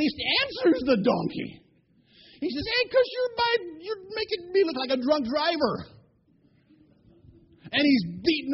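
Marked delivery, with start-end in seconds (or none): 2.7-6.0 high, worked-up scolding; hammed-up exasperated outburst; angry retort. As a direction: snap back at the donkey, high and exasperated. Crazed Balaam answers the donkey angrily.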